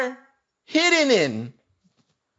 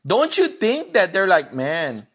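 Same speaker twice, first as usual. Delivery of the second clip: preaching at full force; 5 dB louder than usual. Acted.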